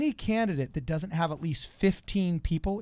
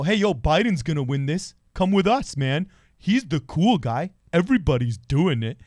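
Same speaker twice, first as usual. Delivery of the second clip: louder, bro-ish hype man pitch; mock Biden supporter; 6 dB louder than usual. Mock Biden fan talking caucus holdouts into joining, in a louder bro voice. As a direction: sell it like a dude-bro hyping his guy, louder. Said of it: "who that Biden supporter was".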